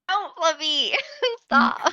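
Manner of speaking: laughing high-pitched protest. Real, not acted. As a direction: beg them to stop through laughter. Laughing plea at the teasing.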